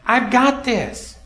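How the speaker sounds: God reassuring. Acted. God's reassuring voice in sermon.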